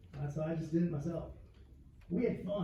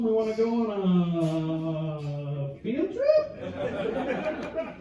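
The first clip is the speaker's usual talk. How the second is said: drawn out, sliding way up into a laugh; mock innocent singsong; 3 semitones higher, 4 dB louder, much slower than usual. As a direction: ask it sweetly like a school outing. Phoning the strip club manager.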